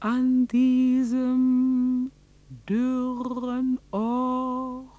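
slow, measured storyteller voice with long pauses; slow story narration. Acted. Narrator tells of the winter miracle, slow and solemn. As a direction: tell it like a solemn legend, word by word.

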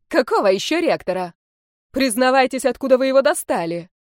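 stern demand. Acted. Teacher demands a confession.

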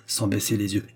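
ordinary delivery, nothing marked

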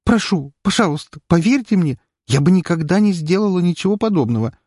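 high, teary begging; tearful plea. A narrator's reading. Weeping girl begs the lawyer to believe her. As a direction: plead through tears. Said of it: "на её глазах появились слёзы".

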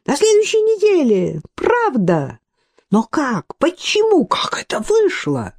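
high-pitched schoolgirl squeal of joy; squealing with delight. A narrator's reading. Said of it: "завизжала от восторга, словно школьница".

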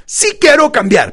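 high, loud, stretched-out declaration; enthusiastic demonstration. Shown as a demonstration. Coach modelling the line for his client, enthusiastic. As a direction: belt it out slowly with conviction. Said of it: "pero así".

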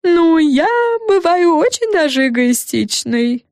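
high, slow admission, not hushed; drawn-out high character voice; muttering fairy. A narrator's reading. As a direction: admit it slowly in a high fairy voice. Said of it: "пробормотала Френ себе под нос".

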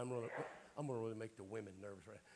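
usual speaking voice

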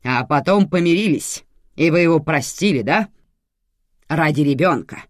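relentless official grilling; interrogating. A narrator's reading. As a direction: press on as if questioning a suspect. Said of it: "спрашивала так, будто вела допрос".

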